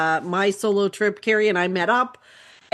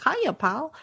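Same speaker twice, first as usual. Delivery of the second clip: Disney character voice; 6 semitones higher than usual. Shown as a demonstration. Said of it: "like, very Disney".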